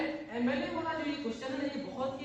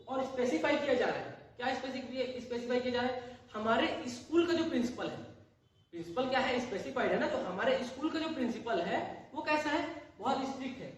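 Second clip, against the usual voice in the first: ordinary delivery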